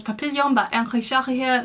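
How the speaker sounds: title and author pronounced in French; French pronunciation dropped into English talk; French accent. Real, not acted. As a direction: switch into a French accent for the name. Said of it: "in an English accent".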